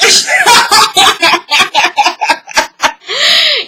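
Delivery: long, high cackling laugh; real laughter. Real, not acted. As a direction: crack up at your own story. Laughs at own retelling.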